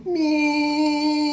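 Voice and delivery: long high-pitched horn noise; imitated car horn. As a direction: hold one high honking note. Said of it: "bibs his on".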